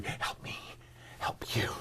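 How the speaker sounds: a breathy, near-whispered imitation of the plea; hushed movie quote. Quoting the movie to the host, hushed imitation. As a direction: softly mimic Tom Cruise pleading in the bathroom. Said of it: "in the bathroom going".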